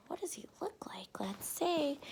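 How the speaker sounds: soft unvoiced whisper. Reader whispering an aside to the child. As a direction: hush it like a secret aside.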